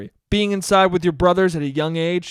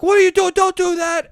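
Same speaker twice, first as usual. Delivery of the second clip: much higher and louder, furious scolding; dad yelling; 14 semitones higher, 7 dB louder than usual. Retold or imitated. Dad scolding his son on the hill, angry shout. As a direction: bark it at a child in shock. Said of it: "But he's like".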